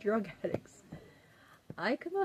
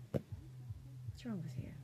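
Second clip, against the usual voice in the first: slow, softer whisper, falling on wrong; 14 dB quieter, slower than usual. Real, not acted. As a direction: breathe it out slowly, no voice. Repeats question whispered.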